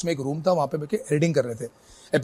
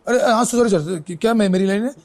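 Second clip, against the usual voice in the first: startled apology; 6 semitones higher, 6 dB louder than usual. Retold or imitated. Startled actor caught missing his cue.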